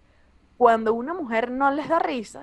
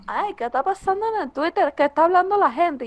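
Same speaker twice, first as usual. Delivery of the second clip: casual self; 6 semitones higher than usual. Retold or imitated. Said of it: "y digo".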